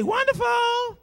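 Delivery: high mock shout; shouted wonderful. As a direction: cry out the word from the pews. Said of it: "he'd say".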